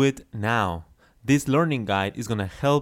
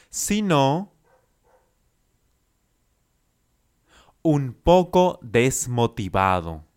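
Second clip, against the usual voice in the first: chunked model phrase; 3 semitones higher than usual. Shown as a demonstration. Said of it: "Repita después de mí".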